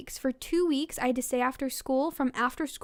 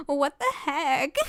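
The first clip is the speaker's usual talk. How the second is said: laughing; 4 semitones higher, 3 dB louder, slower than usual. Real, not acted. Laughing while reading.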